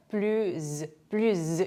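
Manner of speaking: "plus" ending in a buzzing Z; liaison. Shown as a demonstration. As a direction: say each "plus" as "plüz". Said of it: "이렇게 연음이 되는데요".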